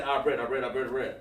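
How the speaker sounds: "our bread" rattled off over and over; too fast. Shown as a demonstration. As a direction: gabble "our bread" in a hurried, run-on string. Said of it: "don't wanna say Hebrew too fast".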